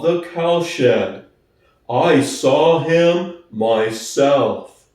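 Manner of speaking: plodding, honest workhorse; Boxer's slow voice. Acted.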